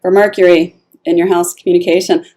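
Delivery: usual speaking voice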